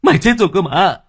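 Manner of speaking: high, loud panicked yelling; terrified shout. Acted. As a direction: scream at the ghost in terror. Terrified master yells at ghost.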